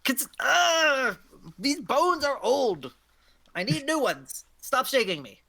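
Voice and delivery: high, strained crone with a groan; drawn-out, high-pitched old-bones grumble; complaining old hag. Acted. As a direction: whine like a hag being shaken.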